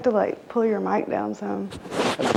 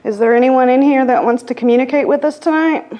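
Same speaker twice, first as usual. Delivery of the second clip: loud call into the dark room; 4 semitones higher, 12 dB louder than usual. Real, not acted. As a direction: project it to reach the spirits. Calling out to spirits.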